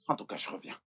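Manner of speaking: very low, hushed aside; muttering. Acted. Doctor alone after the quarrel, muttering quietly. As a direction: mutter it under your breath. Muttering once man has left.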